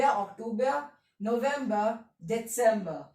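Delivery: जून and जुलाई with Y openings, दिसंबर with TS; measured German drill from जनवरी to दिसंबर, pausing between months; German month recitation. Shown as a demonstration. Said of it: "एक बार को repeat कर लेते".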